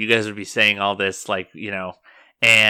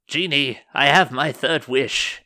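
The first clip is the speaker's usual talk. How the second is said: lightly voiced commanding woman, higher; imperious summons; 5 semitones higher, 4 dB louder than usual. Churchwoman summoning her genie from the lamp, higher and deliberate.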